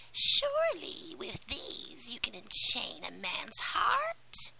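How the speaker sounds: high, whispery menacing witch; a crooning sea witch, sly and cruel. Acted. Sea witch taunts the mermaid.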